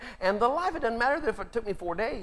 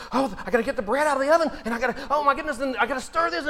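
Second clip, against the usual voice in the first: frantic rush; 6 semitones higher, 4 dB louder, faster than usual. Acted. Martha frantic in the kitchen.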